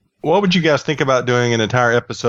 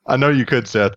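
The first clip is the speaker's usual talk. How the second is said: ordinary talk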